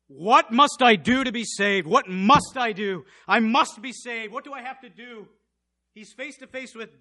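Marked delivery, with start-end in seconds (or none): 0.1-5.3 high, loud, slow begging; desperate plea. Acted. Jailer face to face with death, begging high and loud. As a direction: beg like someone facing death.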